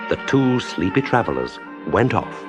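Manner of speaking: ordinary delivery, nothing marked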